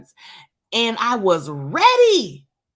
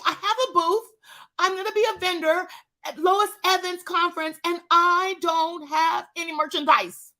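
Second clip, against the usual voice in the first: high-pitched panic; 8 semitones higher than usual. Acted. Panic over missing merchandise.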